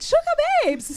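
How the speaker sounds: high, thrilled delivery; excited squeal. Retold or imitated. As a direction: squeal it with glee. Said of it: "She said".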